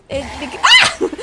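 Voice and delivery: high loud cackle breaking into a shriek; burst of laughter. Real, not acted. Gamer cracking up at an ugly face, laughing hard. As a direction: laugh so hard the words fall apart.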